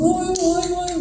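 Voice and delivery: high mock heckling calls; lightly voiced crowd jeering. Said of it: "mereka tuh".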